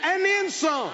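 drawn-out, ringing sermon declarations; emphatic preacher stretching the key words; fervent preaching. Acted. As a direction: proclaim it slowly for the congregation to shout back. Preaches to a responding congregation.

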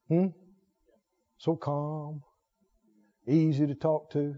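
soft and slow, very gentle; softly showing gentleness; gentle calm. Acted. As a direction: model the easygoing calm.

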